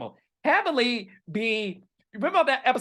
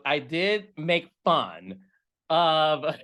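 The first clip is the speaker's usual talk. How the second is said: usual speaking voice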